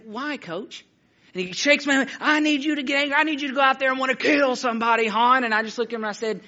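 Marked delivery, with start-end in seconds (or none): 0.1-0.8 put-on small, softer kid voice; bewildered boy. Boy answering his fired-up coach, meek and puzzled. 2.2-5.4 high-pitched, worked-up coach rant; frustrated coach. Retold or imitated. Coach shaking a young player to rile him, frustrated. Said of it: "he was really frustrated".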